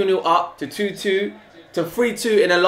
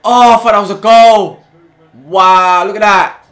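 amazed; 10 dB louder than usual. Real, not acted. Near goal reaction.

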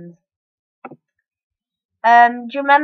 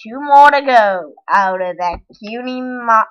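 ordinary talk

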